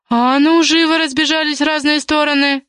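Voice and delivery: high-pitched, sustained order; commanding. A narrator's reading. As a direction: break up a fight with a sharp command. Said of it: "скомандовала Ксюша".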